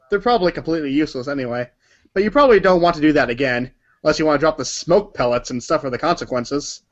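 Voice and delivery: ordinary delivery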